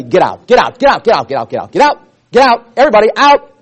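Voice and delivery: loud, rapid-fire shooing out; shouted dismissal. Acted. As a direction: bark it out fast, clearing the whole room. Jesus clearing mourners from the room.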